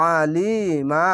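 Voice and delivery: আলিমা slowly, with a throat-pressed ain and long li; slow Quranic recitation; throaty ain, stretched. Shown as a demonstration. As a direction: squeeze the ain and draw out আলিমা. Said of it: "এক আলিফ টেনে ... গলা চিপে পড়বেন".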